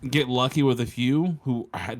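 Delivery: usual speaking voice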